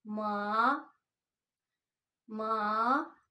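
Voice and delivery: मा rising from low to high; second tone. Shown as a demonstration. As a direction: lift मा upward like a question. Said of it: "low to high". (मा नीचे से ऊपर उठता हुआ)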